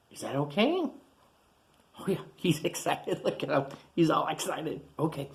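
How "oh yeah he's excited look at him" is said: plain reading voice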